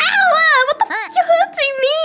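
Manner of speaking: high, fast, mock pained yelp with a laugh. Retelling doctor slamming her hand.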